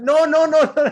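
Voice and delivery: very high, loud hammed-up wail of refusal; mock frantic protest. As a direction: imitate someone pleading in exaggerated panic. Retold frantic refusal, acted out.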